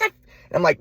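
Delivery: ordinary delivery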